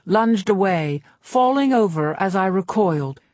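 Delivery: ordinary delivery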